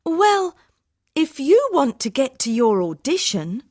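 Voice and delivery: angry. Acted. Harminder challenges the blonde about audition, angry. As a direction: lay down terms crossly. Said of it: "Harminder was speaking angrily".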